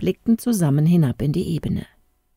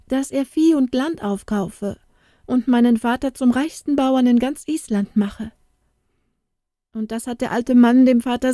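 put-on high, urgent girl's voice, faster in the middle; distressed young girl; 7 semitones higher than usual. A narrator's reading. Inga telling Andri her father wants her wed to Lars. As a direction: pour out the bad news in distress.

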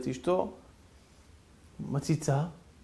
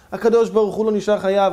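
louder, proclaimed "הקדוש ברוך הוא לא נשאר חייו"; loud emphatic refrain; 3 semitones higher, 6 dB louder than usual. Acted. Preacher repeating his refrain to the congregation, louder and emphatic. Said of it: "repeated refrain".

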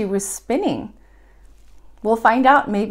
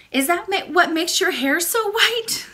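high, quick little princess voice; eager young princess asking; cartoon princess; 7 semitones higher than usual.

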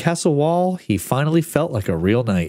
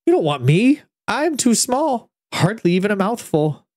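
1 clever little owl; 8 semitones higher than usual. A narrator's reading. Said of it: "he said".

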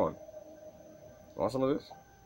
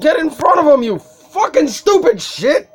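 screaming curses in rage; furious high shouting at the screen; swearing tirade; 10 semitones higher, 7 dB louder than usual. Real, not acted. Raging at game character.

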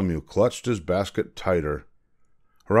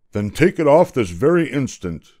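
loud, higher, impatient royal order; louder command; 5 semitones higher, 6 dB louder than usual. A narrator's reading. King orders the boy to doff his hat, commanding loudly. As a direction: raise your voice and insist. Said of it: "commanded the king more loudly than before".

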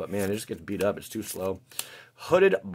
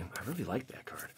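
whisper; 14 dB quieter than usual. Real, not acted. Admiring a card while opening packs.

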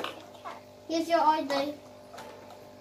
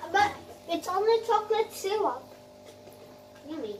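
playful seller tasting treats; chirpy shopkeeper voice; cheery ice cream seller; 7 semitones higher, faster than usual. Pretend.

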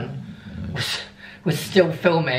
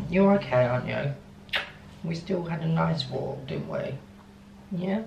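soft, much quieter pet talk; cooing to the dog; 7 dB quieter than usual. Real, not acted. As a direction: whisper your love to the dog.

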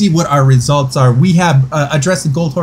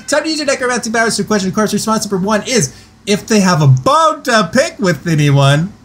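normal talking voice

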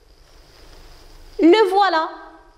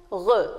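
"Re" said alone, lower; isolated syllable; 6 semitones lower than usual. Shown as a demonstration. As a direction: drop the final "Re" on its own. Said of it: "Et la troisième?". (« re », syllabe finale détachée)

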